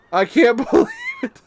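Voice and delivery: can't hold back the laugh at the win; laughing disbelief. Real, not acted.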